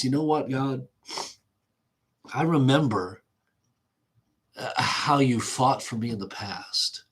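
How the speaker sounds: reflective prayer with long pauses; pausing in prayer. Acted. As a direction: pause long before and after "I remember". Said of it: "then he pauses and he says".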